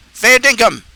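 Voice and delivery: high, punchy Aussie exclamation; Australian accent. Acted. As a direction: throw it out in a broad Australian accent. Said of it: "all I can say in Australian".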